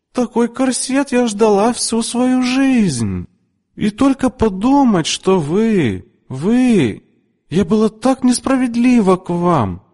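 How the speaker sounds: very high, lilting and gushing; melodious sing-song voice. A narrator's reading. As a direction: sing out her gratitude. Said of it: "её голос звучал мелодично, певуче". (очень высокий мелодичный, певучий голос)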